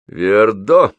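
loud, grim one-word question; gloomy. A narrator's reading. Тургенев questions his departing friend, gloomy. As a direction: ask it grimly, with weight. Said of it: "мрачно спросил Тургенев".

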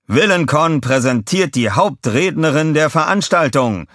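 loud, high booming announcement; booming prize announcer. A narrator's reading. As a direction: boom it over the speakers. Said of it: "dröhnte es aus den Lautsprechern".

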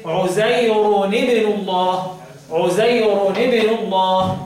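slow recited عزير ابن الله, linked as 'uzayruni-bnu; عزير ابن الله drawn out, with nunation before ابن; tanween with kasra. Shown as a demonstration. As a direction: add a linking -ni between عزير and ابن. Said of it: "بالكسر وكده".

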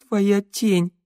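high, plaintive voice; tearful. A narrator's reading. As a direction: say it as if crying. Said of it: "продолжила Санда плачущим голосом". (плачущим голосом)

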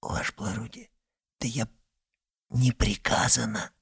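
soft, hushed whisper; leaning in to whisper. Acted. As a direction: lean in and whisper it. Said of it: "вдруг перешёл на шёпот".

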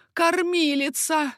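high, drawn-out call for the nurse. A narrator's reading. Dying king calls for his nurse, calling out. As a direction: call out slowly, pitched high. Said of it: "крикнул Карл громче".

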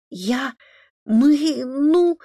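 put-on high, halting boy's voice; hesitant. A narrator's reading. Boy begins confessing to his father, hesitant. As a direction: stall uncertainly, word by word. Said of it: "неуверенно начал мальчик".

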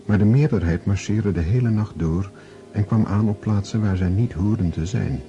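normal talking voice

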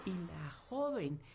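usual speaking voice

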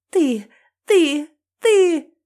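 deranged screaming. A narrator's reading. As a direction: shriek like a madwoman. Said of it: "словно умалишённая кричит она". (безумный крик со слезами)

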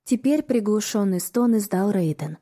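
plain reading voice

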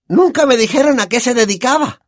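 high, brash, boozy aunt voice; Aunt Marge. Acted. Aunt sneers at the family over brandy, high brash voice. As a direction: play a loud, tipsy, condescending old aunt.

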